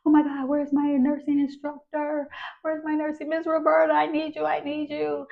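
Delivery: high, frantic calling out; pleading high for help in a panic; retold panic searching for the instructor; panicked. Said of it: "So I'm running around like".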